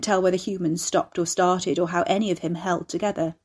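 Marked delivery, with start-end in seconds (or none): none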